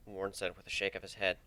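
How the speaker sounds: ordinary delivery, nothing marked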